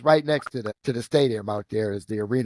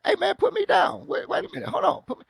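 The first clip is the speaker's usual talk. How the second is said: much higher, strained squeaky plea; hammed-up little guy dangling in the air; mock panicked plea. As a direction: beg to be put down. Himself pleading while held up.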